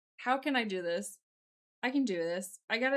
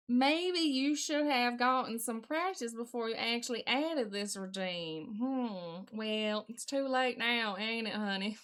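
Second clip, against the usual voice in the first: put-on Southern accent; 2 semitones lower than usual. Streamer chiding over lack of practice, put-on Southern accent. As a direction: muse in a mock Southern accent. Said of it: "This is a terrible, terrible Southern accent".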